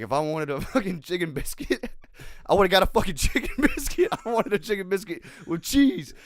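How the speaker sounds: hammed-up outrage broken by laughter; mock furious. Quoting a friend's fast-food rant, hammed-up anger. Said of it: "he was furious".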